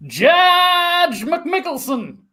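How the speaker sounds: high, slow drawn-out announcement; hammed-up grand entrance; announcing a judge. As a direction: stretch "Judge" like a ring announcer.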